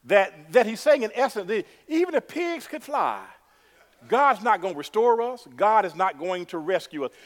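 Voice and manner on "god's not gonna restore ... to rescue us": normal talking voice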